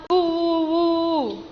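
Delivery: woo woo woo, round hoots; gentle owl call for children. Shown as a demonstration. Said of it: "like that sound".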